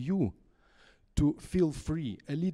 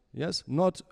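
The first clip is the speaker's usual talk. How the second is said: ordinary talk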